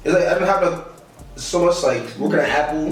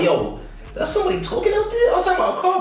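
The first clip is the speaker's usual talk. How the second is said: high startled whisper-call to himself. Retold or imitated. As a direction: react like you just heard someone outside. Hearing voices outside car.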